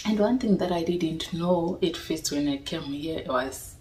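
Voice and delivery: ordinary delivery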